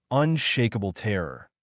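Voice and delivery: plain reading voice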